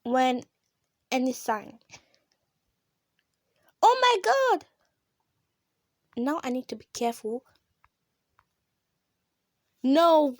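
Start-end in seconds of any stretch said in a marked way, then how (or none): 3.8-4.6 high, loud oh my God; excited shriek. Real, not acted. Player overjoyed at a lucky break, squealing. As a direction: burst out with delight. Gamer thrilled by luck.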